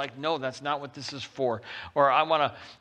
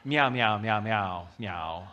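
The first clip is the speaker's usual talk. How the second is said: longing little meows, fading lower; cat meowing; 4 semitones lower, slower than usual. Retold or imitated. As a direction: meow up at your owner like a needy cat. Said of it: "your cat looked up at you longingly and said".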